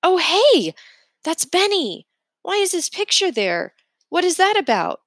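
high, loud and urgent; boy spotting his friend's picture, startled; startled outburst. A narrator's reading. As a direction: blurt it out in sudden alarm. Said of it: "I'm saying".

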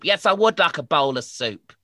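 loud, high, gruff demanding snowman; grumpy snowman. Acted. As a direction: bark like a bossy snowman.

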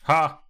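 high, loud single "Ha!"; sudden loud bark of laughter; gloating "Ha!". Real, not acted. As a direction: burst out with a triumphant laugh. Gloats as enemy stumbles.

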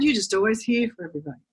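ordinary delivery

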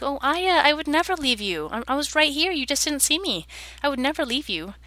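put-on high, soothing reassurance to a child; comforting mother. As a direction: hush her fears, gently. Mother comforting crying daughter.

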